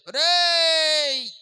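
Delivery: long, very high, very loud call across the fields. Shown as a demonstration. Farmer calling a worker far off in the fields, long loud shout. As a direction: stretch the call out to reach far away. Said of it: "గట్టిగా పిలవాలి".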